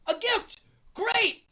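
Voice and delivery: thrilled to buy his way out; relieved excitement. Real, not acted. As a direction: leap on the way out. Relief at being offered a bribe.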